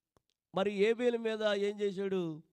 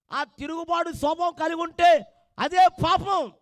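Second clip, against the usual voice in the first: loud, raised preacher's call; full-force preaching; 5 semitones higher, 9 dB louder than usual. Acted. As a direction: drive the sermon home to the congregation. (గట్టిగా ప్రసంగం)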